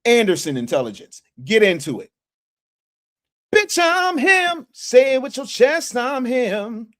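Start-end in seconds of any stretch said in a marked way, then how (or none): 3.5-6.9 high, drawn-out boastful holler; slow, high-pitched brag; hammed-up boast. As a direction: crow it with swagger, pitched way up. Performs a boastful catchphrase.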